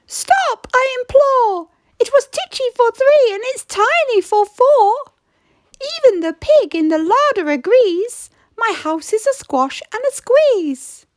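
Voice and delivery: high, whiny plea for help; pleading old lady. Acted. Old lady begging the wise old man for help, high and pleading.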